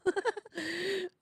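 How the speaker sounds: real high-pitched laughing burst; high squealing laugh; burst of laughter. Real, not acted. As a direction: crack up at the joke. Reacting to joke comment.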